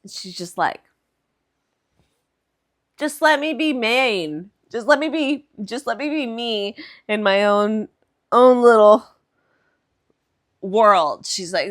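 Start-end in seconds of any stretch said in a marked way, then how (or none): 3.0-11.3 imitating a fed-up mom, raised voice; exasperated protest. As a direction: plead loudly to be left alone. Mom's acted protest.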